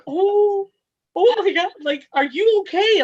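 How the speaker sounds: high, loud startled concern; alarmed. Retold or imitated. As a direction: react like something's gone wrong. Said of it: "I'm like".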